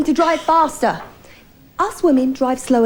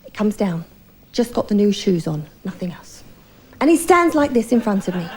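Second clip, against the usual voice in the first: ordinary talk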